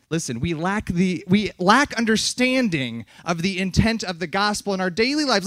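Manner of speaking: ordinary talk, nothing marked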